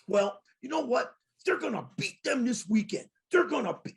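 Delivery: high retort, imitating her; mock mad. Mad show host answering a taunt about her team, high mock defiance. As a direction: pitch it up like her defiant comeback. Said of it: "she's mad".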